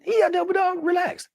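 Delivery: very high, teasing mock-President voice; squeaky-high chummy President impression; imitating the President. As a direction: play the President as high and playful. Retelling the President's lines.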